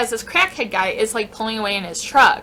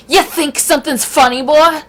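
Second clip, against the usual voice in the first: loud, high, confrontational yelling in imitation; acted belligerent rant, raised pitch and volume; screaming aggressor; 7 semitones higher, 8 dB louder than usual. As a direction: yell it like a crazed man itching for a fight. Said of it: "and starts screaming".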